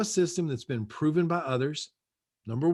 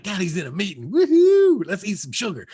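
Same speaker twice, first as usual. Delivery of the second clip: imitating kids; 9 dB louder than usual. Dad mimicking his kids celebrating while he's busy. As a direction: cheer like a kid let loose on sugar.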